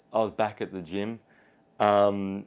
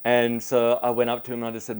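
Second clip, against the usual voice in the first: ordinary delivery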